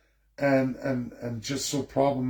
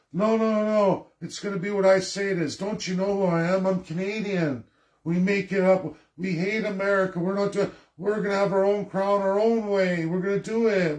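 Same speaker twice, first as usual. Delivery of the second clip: mock defiant Canadian; 5 semitones higher than usual. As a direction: play a stubborn spoiled brat digging in. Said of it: "nobody wants to look at".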